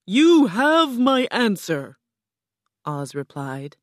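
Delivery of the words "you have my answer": loud, grand, drawn-out voice; booming Oz. A narrator's reading. Oz questions the travelling girl, booming. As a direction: boom it out like a mighty wizard.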